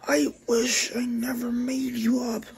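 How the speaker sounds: high put-on voice, pleading; character voice. Takomi telling Psycho she regrets her, high-pitched. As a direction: say it high and upset.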